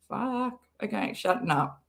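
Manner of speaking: ordinary delivery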